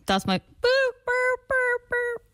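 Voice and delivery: very high boop boop foghorn noise; slow high hoots like a foghorn. Shown as a demonstration. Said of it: "That's my foghorn noise".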